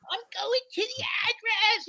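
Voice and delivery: high, dopey put-on voice; officer mimicking his driver; mock chauffeur. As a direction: play the driver as clueless. Quoting the clueless chauffeur.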